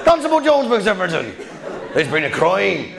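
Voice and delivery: hammed-up West Country villager; broad mock West Country, pitch swooping; bad West Country accent. Villagers raising the alarm over car theft, mock West Country accent. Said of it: "shit attempt at the accent".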